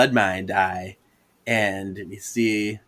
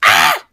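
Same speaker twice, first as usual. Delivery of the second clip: loud hammed-up yelp of pain; shocked yelp; 6 semitones lower, 9 dB louder than usual. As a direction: yelp like being zapped. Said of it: "she gets shocked".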